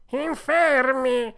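loud, high, drawn-out cat voice; echoing cat. A narrator's reading. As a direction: wail the fox's last word back loudly. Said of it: "ripeté il gatto". (gatto che fa eco, forte e strascicato)

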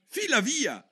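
put-on high, sharp little-girl shoo; shooing away. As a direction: chase the bird off. Alice shoos the pigeon. (Alice scaccia il piccione)

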